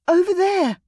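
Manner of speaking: high, loud pointing shout. A narrator's reading. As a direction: call out to guide him. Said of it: "Rosamond shouted".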